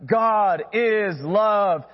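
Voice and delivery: slow, stressed "God is love"; slow emphatic declaration. Acted. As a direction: weigh every word, very slow. Sermon's central claim, emphasized.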